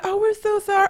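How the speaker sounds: high, sugary fake apology; mocking the mom. Neighbor mom apologizing to dog owner, high mocking voice. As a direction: squeak it out like a polite mom. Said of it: "she was like".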